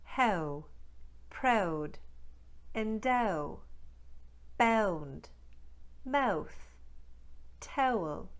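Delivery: Newfoundland "How", "proud", "mouth" starting on eh; Newfoundland accent, eh-uh diphthong. Shown as a demonstration. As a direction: drill ow words in a Newfoundland accent. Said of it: "Let's try some more".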